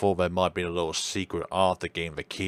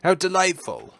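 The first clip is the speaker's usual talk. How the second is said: higher, delighted doctor voice; raised-pitch doctor admiring the room; doctor character voice; 10 semitones higher, 5 dB louder than usual. Acted. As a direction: gush about it like the genteel doctor. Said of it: "I'm gonna drop this voice".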